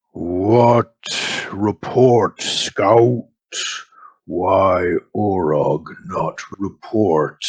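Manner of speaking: slow, broken orc speech; a lightly voiced orc leader. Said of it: "you hear a voice, speaking in Orcish".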